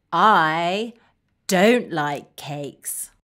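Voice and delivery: low, slow, downcast; deep, deliberate glum refusal; glum dislike. Shown as a demonstration. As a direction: say it low and disappointed, word by word. Modelling dislike of cakes.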